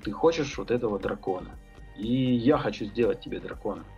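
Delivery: normal talking voice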